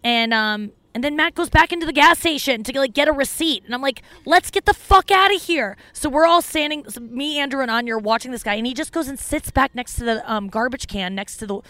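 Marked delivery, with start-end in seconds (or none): 4.2-5.8 higher-pitched urgent plea to leave; urgent retold exclamation. Telling friends about a creepy man at the gas station, urgent. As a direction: urge the group to flee, pitched up.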